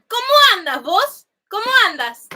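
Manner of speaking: high, lilting Uruguayan Spanish; Uruguayan accent. Shown as a demonstration. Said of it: "They say".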